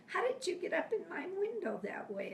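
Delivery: imitating an incredulous question, pitched high; high, mildly loud mock astonishment; amazed retold demand. Husband to wife about the window, insistent amazement.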